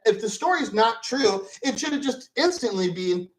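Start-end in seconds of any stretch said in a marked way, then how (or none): none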